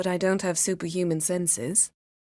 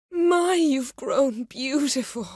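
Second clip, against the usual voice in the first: admiring; 7 semitones higher, slower than usual. Acted. Admiring remark, slow.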